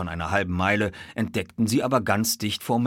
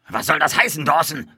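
high, loud demand; grim boss bellowing; angry shout; 8 semitones higher, 6 dB louder than usual. A narrator's reading. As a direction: yell at your stablehand. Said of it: "schrie er".

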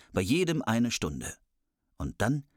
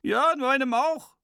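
very high, loud dwarf; squeaky dwarf; 14 semitones higher, 5 dB louder than usual. Acted. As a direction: pipe up as a tiny dwarf. Dwarf chiming in.

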